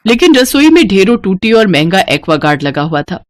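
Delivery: plain reading voice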